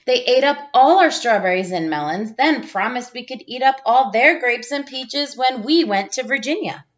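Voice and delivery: put-on gentle storybook read-aloud for young listeners; reading to children. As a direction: read it like a teacher sharing a picture book with the class. Reads picture book to class.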